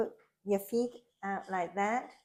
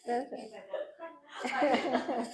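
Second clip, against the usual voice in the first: laughing; 4 semitones higher than usual. Real, not acted. Giggling through yoga cue.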